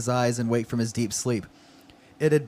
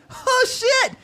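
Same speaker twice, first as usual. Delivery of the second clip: high-pitched mock shock; alarmed outburst. Acting out his answer to a rare call from Otto, alarmed outburst. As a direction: yelp it in exaggerated alarm. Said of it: "I'd be like".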